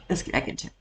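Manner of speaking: breathy whisper to herself; whispered aside. Real, not acted. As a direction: whisper it before pressing play. Mutters before resuming the video.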